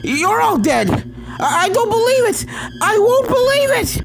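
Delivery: very high, loud panicked shrieks; hysterical screaming. Acted. Starlight screaming at the ghosts around her, very high and loud. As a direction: come apart in denial.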